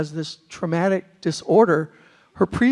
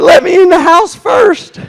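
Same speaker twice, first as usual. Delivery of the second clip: exasperated retort; 10 semitones higher, 14 dB louder, slower than usual. Retold or imitated. Retold reply to the kid's question.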